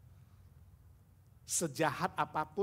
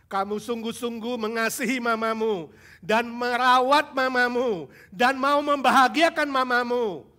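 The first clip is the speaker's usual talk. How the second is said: loud, high sermon voice, slow at first; fervent preacher pressing the congregation; preaching at full force; 6 semitones higher, 11 dB louder than usual. Acted. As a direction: preach it hard to the youth.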